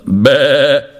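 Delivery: quavering little goat "бе"; drawn-out goat's bleat. A narrator's reading. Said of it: "вимовила".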